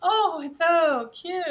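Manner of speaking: high slow cooing; gushing over cuteness. Retold or imitated. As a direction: coo like a smitten child. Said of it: "how you tell your five-year-old".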